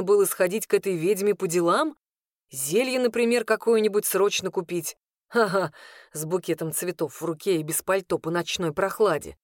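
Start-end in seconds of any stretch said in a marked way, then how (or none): none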